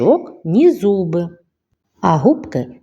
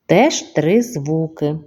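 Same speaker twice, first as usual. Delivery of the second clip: ordinary talk, nothing marked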